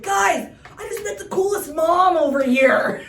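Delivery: loud, high mock shout to the friends; calling out. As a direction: imitate an excited kid hollering to his buddies, drawn out. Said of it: "he starts walking. He's like".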